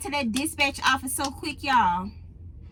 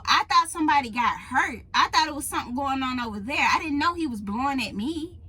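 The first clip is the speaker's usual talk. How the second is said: ordinary talk, nothing marked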